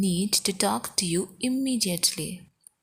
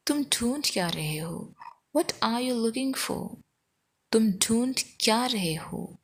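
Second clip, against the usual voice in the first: ordinary talk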